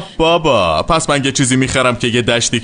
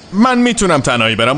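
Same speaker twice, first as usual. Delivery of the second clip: normal talking voice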